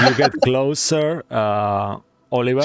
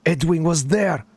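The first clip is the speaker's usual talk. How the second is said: put-on shaken soldier, light Russian accent, higher pitch; half Russian accent; 5 semitones higher than usual. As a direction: recount the horror with a hint of Russian. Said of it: "half Russian accent now".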